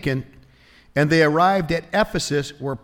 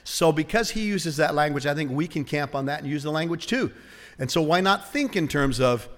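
ordinary talk, nothing marked

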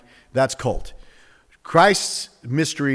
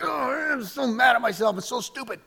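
higher, half-sung cry of self-annoyance; mock frustrated yelp; 7 semitones higher, 4 dB quieter than usual. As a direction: play the guy who just hit his thumb. Acting out smashing his hand.

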